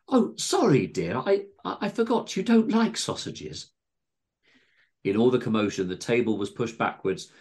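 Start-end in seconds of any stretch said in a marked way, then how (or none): 0.1-3.7 high-pitched, stammering fussing; flustered apology. A narrator's reading. Missus Tingleberry apologising to panicked John, flustered. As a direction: fall over yourself apologising.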